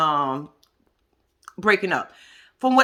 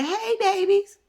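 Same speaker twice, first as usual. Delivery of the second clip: mimicking mom; 10 semitones higher, slower than usual. Mother greeting her kids.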